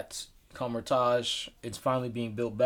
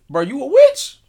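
shouted high and loud; loud mock outburst; 12 semitones higher, 16 dB louder than usual. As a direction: yell it like a stunned actor. Acting out the actor's line.

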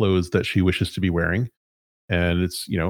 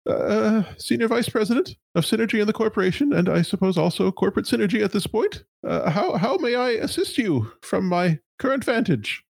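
high, nervous corporate stammer; a flustered high-pitched executive; 13 semitones higher than usual. Acted. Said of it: "And she says".

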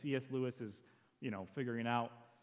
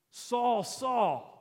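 high, loud mock accusation; accusing tone; 9 semitones higher, 7 dB louder than usual. Shown as a demonstration. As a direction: call the name out as an angry rebuke. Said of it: "is in the tone of the evil one".

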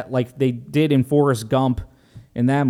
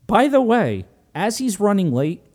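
ordinary talk

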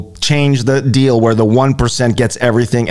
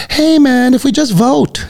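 mock the hopeful voter, pitched up high; mock naive voter. Mocking naive voters who think voting helps, high mock voice. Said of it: "we're all like".

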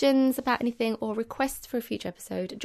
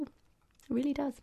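whisper; 5 semitones higher, 5 dB quieter, slower than usual. Shown as a demonstration. Hushed repeat of the point.

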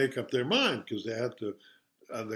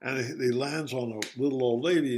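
ordinary delivery

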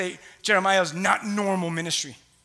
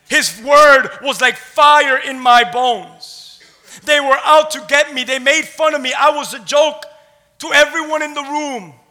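loud, emphatic testimony with weighted words; full-force preaching; 3 semitones higher, 7 dB louder than usual. A narrator's reading. Preacher retells Jeremiah's ordeal to the congregation, forceful. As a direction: proclaim it as the wronged prophet.

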